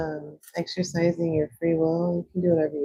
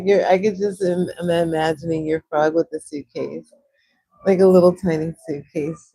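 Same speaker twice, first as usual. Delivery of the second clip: ordinary talk, nothing marked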